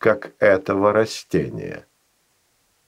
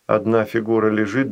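plain reading voice

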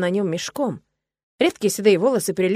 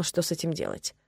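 ordinary delivery, nothing marked

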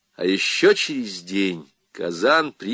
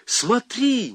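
even higher amazed exclamation; crying out; 13 semitones higher than usual. A narrator's reading. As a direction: cry it out high, in astonishment. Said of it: "крикнул он". (высокий изумлённый возглас)

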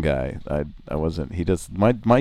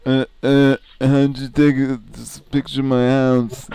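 higher, louder dopey voice; dimwit voice; 7 semitones higher, 3 dB louder than usual. Acted. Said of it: "I was just doing a dimwit voice".

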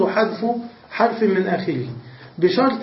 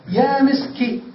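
"يا مسكي" lifted high, ending on the i; called out name; 6 semitones higher, slower than usual. Shown as a demonstration. Teacher to class: "يا مسكي" sung out high. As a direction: call "يا مسكي" out like summoning someone. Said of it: "بالكسرة على لغة من ينتظر".

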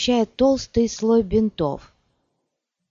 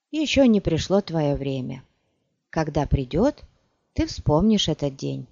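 ordinary delivery

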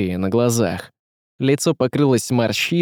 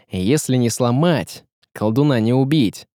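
ordinary delivery

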